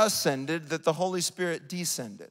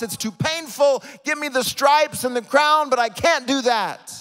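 high, loud plea of giving up; impassioned preaching; 7 semitones higher, 6 dB louder than usual. Acted. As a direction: preach it as a forceful, high-pitched protest.

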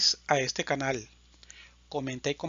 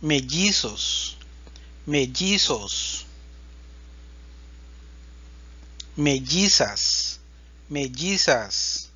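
slow careful words; slower than usual. Shown as a demonstration. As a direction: spell it out slowly for learners. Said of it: "El sonido de la doble L".

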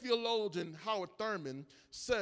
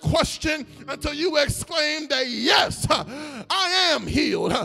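louder, rhythmic preaching cries over the music; full-force preaching; 5 semitones higher, 10 dB louder than usual. Acted. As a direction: lift the church, call it out.

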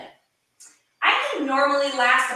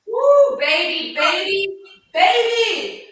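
excited cheer; 8 semitones higher, 8 dB louder, much slower than usual. Real, not acted. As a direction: hype yourself up, louder each time. Cheering through hard plank hold.

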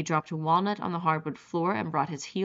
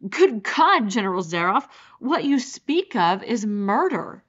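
loud, raised-pitch cry of outrage; appalled outburst; 4 semitones higher, 8 dB louder than usual. A narrator's reading. Rainsford accusing the general of murder, loudly appalled. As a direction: burst out loudly, then press the accusation.

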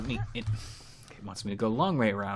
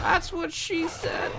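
high, slow squeaky delivery; squeaky joke voice; slower than usual. Pretend. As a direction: squeak the punchline slowly.

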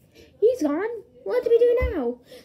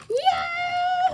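high, long play whoop; excited whoop; 9 semitones higher than usual. Pretend. Toy searchers going to get him, excited whoop. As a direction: let it fly up high. Pretend chase sets off.

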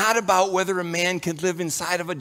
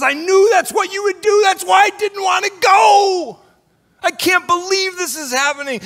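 high, loud, exasperated outburst; angry Jonah; 13 semitones higher, 5 dB louder than usual. Retold or imitated. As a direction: rant at God like a fed-up prophet. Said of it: "Jonah says".

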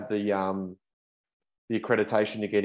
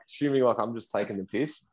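ordinary delivery

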